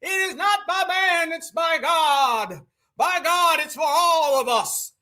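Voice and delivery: Southern accent mocked as a fake preacher. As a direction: ham up a Southern preacher's "by God". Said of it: "Somehow she got a Southern accent".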